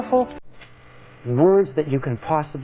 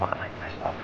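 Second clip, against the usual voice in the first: I like myself breathed as a whisper; whispered to yourself; 14 dB quieter than usual. Shown as a demonstration. Coach contrasting silent self-talk, whispered. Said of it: "If you say to yourself".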